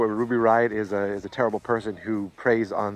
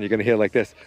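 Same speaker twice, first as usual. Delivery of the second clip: ordinary talk, nothing marked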